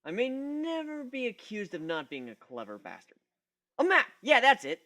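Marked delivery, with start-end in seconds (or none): 3.8-4.8 higher, louder mock realization; faked eureka. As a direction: ham up the sudden idea. Pretends to find the word.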